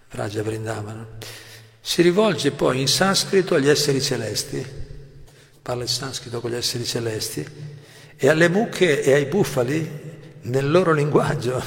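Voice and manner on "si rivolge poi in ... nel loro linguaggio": raised, projected reading of a sacred text; lightly declaimed text reading; reading aloud from a text. Acted. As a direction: read the passage out to the class.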